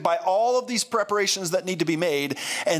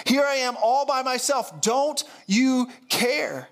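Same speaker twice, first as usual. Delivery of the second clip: anguished plea; 7 semitones higher than usual. Acted. Martha crying out to Jesus that he doesn't see her, pleading high. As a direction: beg him to notice you.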